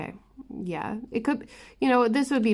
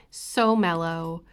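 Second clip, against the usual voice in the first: usual speaking voice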